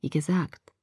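soft, soothing voice; lullaby-like. A narrator's reading. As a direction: murmur it like a lullaby. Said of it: "so schön wie ein Wiegenlied".

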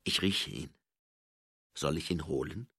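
low, menacing growl with long pauses. A narrator's reading. Angua threatening the baroness over the stone, low growl. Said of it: "knurrte Angua".

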